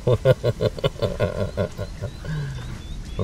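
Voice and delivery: long run of chuckles trailing off; amused laugh. Real, not acted. Husband recalling teasing his wife, laughing.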